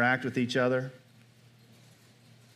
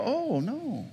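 mock backing off; 9 semitones higher, 4 dB quieter than usual. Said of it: "Most of the time they go".

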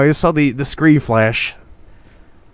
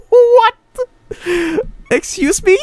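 a very high squealing laugh into a loud what; shrieking laugh then what; 5 dB louder than usual. Real, not acted. Streamer laughing in shock at the scare.

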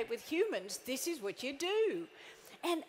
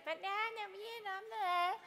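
whiny complainer; 8 semitones higher, 3 dB quieter, slower than usual. Retold or imitated. Complaining staff member.

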